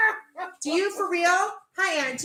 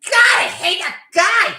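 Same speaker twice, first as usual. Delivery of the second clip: slow, loud, high cry of loathing; exasperated rant; 8 semitones higher, 9 dB louder, slower than usual. Real, not acted. Host venting about the show's creator, exasperated shout. Rants about Seth MacFarlane.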